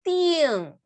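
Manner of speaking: ting starting high and falling; fourth tone. Shown as a demonstration. Teacher showing fourth tone, ting falling. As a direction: drop "ting" sharply from high. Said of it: "Cuarto tono".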